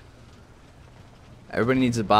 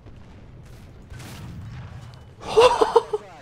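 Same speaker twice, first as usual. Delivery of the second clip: high breathy laugh. Real, not acted. Celebrating a big kill.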